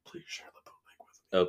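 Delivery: quick, hushed whisper; furtive begging under the breath; whispered plea. Pretend. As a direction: whisper it like a secret request. Pleading for an illicit bootleg recording.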